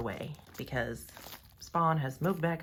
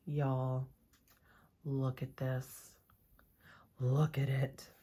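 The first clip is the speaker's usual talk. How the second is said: awed hush; 3 semitones lower, 5 dB quieter, slower than usual. Real, not acted. Awed by the practice hand.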